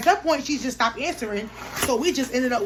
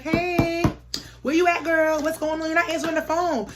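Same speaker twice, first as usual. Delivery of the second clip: raised, higher call through a door; 6 semitones higher than usual. Retold or imitated. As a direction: call out to a friend who won't answer. Banging on door, calling out.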